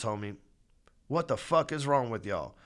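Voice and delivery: usual speaking voice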